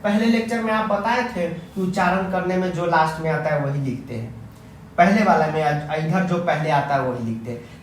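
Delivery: ordinary talk, nothing marked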